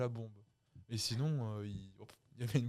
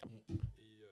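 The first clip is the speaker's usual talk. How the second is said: usual speaking voice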